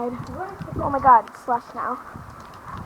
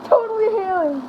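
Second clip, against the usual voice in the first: excited disbelief; 7 semitones higher than usual. Real, not acted. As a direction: gush about the hail.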